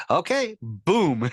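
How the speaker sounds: slow, high, confident announcer read; like a seasoned radio pro; pro radio actor. Retold or imitated. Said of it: "a total pro radio actor".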